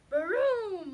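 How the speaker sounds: mock thunder roar, high and loud; booming thunder. A narrator's reading. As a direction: boom it out like thunder. Thunder sound in story.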